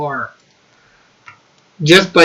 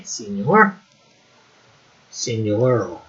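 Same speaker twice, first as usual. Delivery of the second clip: swooping, playful mock Spanish accent; loosely imitated Spanish accent; changeable Spanish accent; 8 semitones lower, 7 dB quieter, slower than usual. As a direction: put on a wobbly Spanish accent. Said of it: "I'm always changing that accent".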